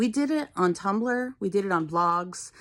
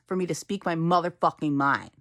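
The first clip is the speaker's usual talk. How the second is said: usual speaking voice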